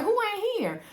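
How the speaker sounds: high-pitched, incredulous questioning; puzzled surprise. Retold or imitated. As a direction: act out your baffled reaction, pitched high. Acting out puzzled reaction to Jeep.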